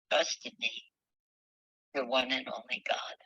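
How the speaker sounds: hushed, almost whispered closing line; soft God voice. Acted. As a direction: breathe the last words as a gentle God.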